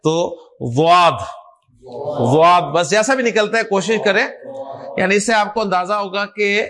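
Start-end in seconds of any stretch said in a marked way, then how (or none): 0.8-2.6 واد، نہ زوئے نہ دال کی آواز، درمیانی; واد، زبان کے کنارے داڑوں کے پیچھے لگا کر; ض کا عربی تلفظ. Shown as a demonstration. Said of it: "نہ تو زوئے کی آواز آئے نہ دال کی آواز آئے". (استاد طلبہ کو ض کی درمیانی آواز سکھاتا ہے)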